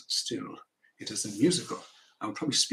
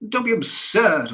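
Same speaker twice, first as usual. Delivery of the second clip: loud, high, drawn-out dismissal; indignant husband's rebuff; scornful rebuff; 8 semitones higher, 10 dB louder than usual. Acted. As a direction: slap the idea down loudly and slowly. Father rebuffs wife.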